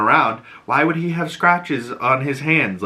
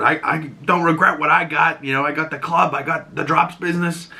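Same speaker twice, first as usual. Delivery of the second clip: higher put-on mobster voice; 6 semitones higher than usual. Driver boasting about what he got, defiant put-on voice. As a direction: imitate a defiant gangster justifying himself.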